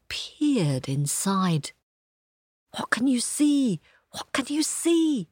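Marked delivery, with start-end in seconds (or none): 2.8-3.8 excited hushed whisper; eager whisper. A narrator's reading. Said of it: "asked the others eagerly". 4.1-5.3 high eager voiced question. A narrator's reading. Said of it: "asked the others eagerly".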